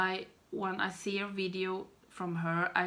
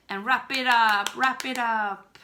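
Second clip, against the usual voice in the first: sing-song call; 4 semitones higher, 10 dB louder than usual. Real, not acted. Telling herself to finish.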